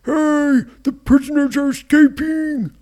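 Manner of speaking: high, loud alarm yell; pirate yell. A narrator's reading. Pirate raising the alarm on the escape, yelling high. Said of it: "the pirate yelled".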